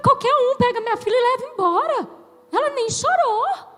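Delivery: high, hurried tearful wail; mock crying. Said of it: "Por que que você tá chorando?".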